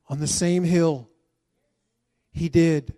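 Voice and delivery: loud, rising sermon climax; preaching at full force. Acted. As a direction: drive it home to the congregation.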